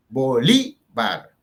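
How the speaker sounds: bolívar slowly split, loud on LÍ; bo-LÍ... var, syllable by syllable; stress on penultimate syllable. Shown as a demonstration. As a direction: punch the middle syllable of bolívar. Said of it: "llevan la fuerza en la penúltima sílaba".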